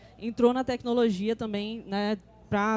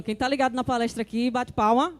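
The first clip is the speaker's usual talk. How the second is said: normal talking voice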